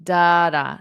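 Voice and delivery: "da, da" with the first beat strong; stress pattern demo. Shown as a demonstration. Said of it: "syllable stress on the first syllable".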